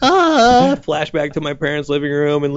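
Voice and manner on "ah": very high, squeaky "ah"; mock nervous voice-crack squeal. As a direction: let out a comic high squeak. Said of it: "my voice is still cracking".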